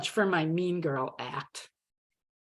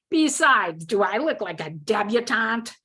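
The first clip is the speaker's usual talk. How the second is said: mild snarl, raised pitch on the attack; 4 semitones higher, 3 dB louder than usual. A narrator's reading. Mean-girl act to a new roommate, snarling. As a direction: hurl the question like a challenge. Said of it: "hurled the words at her, ending my question with a snarl".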